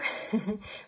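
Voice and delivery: a quick, loud self-mocking laugh; short laugh. Real, not acted. Coach laughing at herself for being so chatty. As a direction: laugh at yourself for talking too much.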